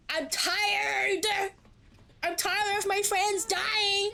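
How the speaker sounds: real frustrated outburst. Real, not acted. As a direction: vent real exhaustion and anger. Streamer upset at friend's death.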